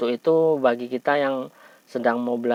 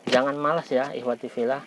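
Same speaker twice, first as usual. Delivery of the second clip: normal talking voice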